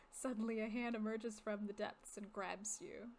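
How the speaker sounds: usual speaking voice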